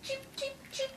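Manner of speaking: tiny squeaky cheeping; chick cheeps. Acted. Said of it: "They go".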